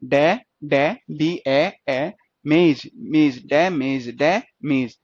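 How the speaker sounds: slow, separate beats: short-vowel "डै", long-ए "मेज", then "डैमेज"; sounding out syllables. Shown as a demonstration. Teacher showing students the long ए in "मेज", sounded out piece by piece. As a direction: break "डैमेज" into डै and मेज, each held apart. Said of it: "बड़े ए की sound आ रही है".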